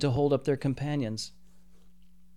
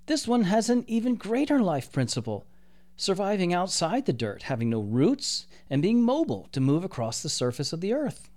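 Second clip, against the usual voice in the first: lightly voiced, higher, wondering angel; awed angel; 6 semitones higher than usual. A narrator's reading. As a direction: marvel at it like an angel. Gabriel explains in awe.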